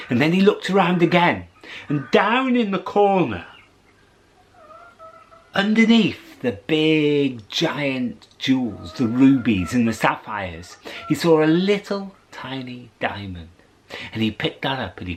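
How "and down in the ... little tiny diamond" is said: ordinary delivery, nothing marked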